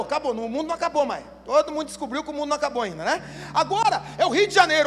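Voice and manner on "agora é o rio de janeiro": ordinary talk, nothing marked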